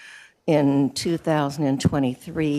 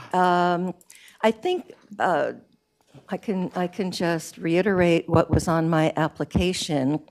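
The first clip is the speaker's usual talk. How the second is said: normal talking voice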